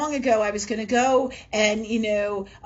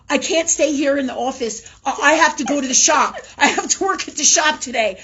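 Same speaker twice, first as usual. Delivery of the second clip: higher, louder mock complaint; imitating restless coworker; 6 semitones higher, 7 dB louder than usual. Imitating Rachel complaining she can't stay in the office, higher and louder. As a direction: play Rachel whining that she must leave. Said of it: "she'd be like".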